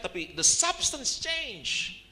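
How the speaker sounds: "the substance change" high, loud and drawn out; emphatic preaching. Real, not acted. Preacher hammering home his key point.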